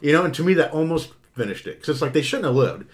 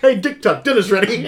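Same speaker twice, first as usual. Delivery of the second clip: laughing, calling out dinner's ready; calling kids to dinner; 6 semitones higher, 6 dB louder than usual. Retold or imitated. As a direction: call him to the table, laughing. Mock parent calling to dinner.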